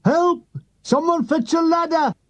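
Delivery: stuck soldier yelling for a ladder; shouting for help. Retold or imitated. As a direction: call out desperately from up high. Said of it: "Forrest shouts".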